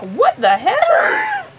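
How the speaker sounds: very high, stretched-out mock outrage; hammed-up disbelief. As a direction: squeal it slowly as a shocked crowd. Crowd of characters reacting in disbelief.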